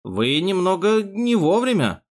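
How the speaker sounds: timid, dragged-out objection; mumbling. A narrator's reading. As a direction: mumble it uneasily. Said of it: "промямлил Фриц".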